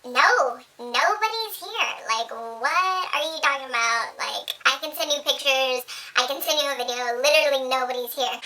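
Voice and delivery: lie smoothly to mom; feigned innocence. Retold or imitated. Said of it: "I was just like".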